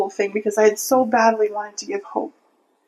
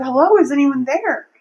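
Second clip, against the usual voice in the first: louder, higher retold call; imitated yell, raised but controlled; 4 semitones higher, 6 dB louder than usual. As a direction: call into a house to see who answers. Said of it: "I'm just gonna yell out".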